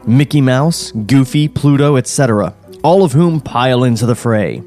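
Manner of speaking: ordinary talk, nothing marked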